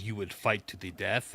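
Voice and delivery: lightly voiced Bane, low and soft; a hint of a menacing villain; Bane quote. Fan quoting Bane's threat to Batman, low. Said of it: "when Bane looks at Batman in that episode and goes".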